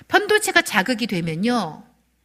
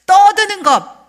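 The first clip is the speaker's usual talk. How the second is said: high loud slow shout; preaching shout; 12 semitones higher, 11 dB louder, slower than usual. Real, not acted. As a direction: cry it out at full force. (크게 외치며 설교)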